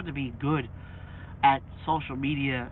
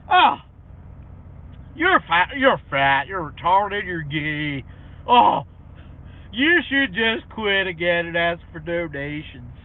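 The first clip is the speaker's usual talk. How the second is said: mocking trolls; 8 dB louder, slower than usual. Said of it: "mocking trolls again".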